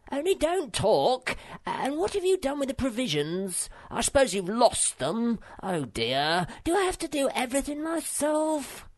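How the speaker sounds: high, bossy little creature giving orders; bossy little Sniff. Acted. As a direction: play the self-important path pioneer, pitched high. Sniff voiced in story.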